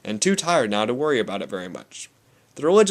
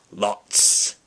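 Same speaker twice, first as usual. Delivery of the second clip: with feeling; 3 semitones lower than usual. Acted. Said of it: "he said with feeling".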